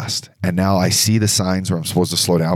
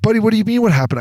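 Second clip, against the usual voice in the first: high-pitched mock indignation; retold indignant comeback; 12 semitones higher, faster than usual. Crashed skier answers his buddy, retold indignant comeback. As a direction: throw the question back, incredulous and high.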